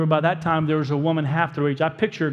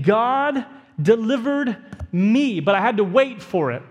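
slow, emphatic declaration; a preacher voicing David's slow declaration; 4 semitones higher than usual. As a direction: declare it slowly, leaning on each word. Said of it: "David is making a declaration".

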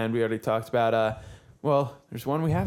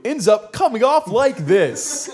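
loud, hammed-up used-car salesman; smarmy salesman voice; 8 semitones higher, 7 dB louder, slower than usual. Shown as a demonstration. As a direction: push it out like a smarmy huckster. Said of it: "almost every voice I do".

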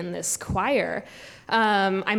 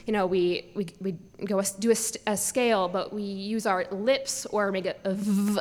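normal talking voice

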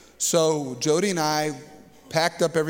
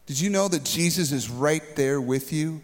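ordinary delivery

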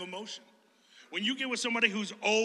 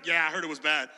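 mock negative Nancy; 5 semitones lower than usual. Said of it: "negative Nancy".

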